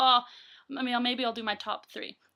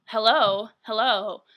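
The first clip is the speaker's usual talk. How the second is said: loud, impatient mock hellos; imitated insistent calling; 10 dB louder, slower than usual. Woman at speaker box to busy worker, mock insistent calling. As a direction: demand service like an ignored customer. Same customer calling out again.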